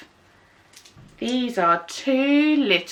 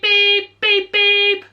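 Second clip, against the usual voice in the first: high, louder beeping horn; car horn; 9 semitones higher, 4 dB louder, slower than usual. Shown as a demonstration. Teacher honks like a car horn for the children. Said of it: "Sometimes it goes beep".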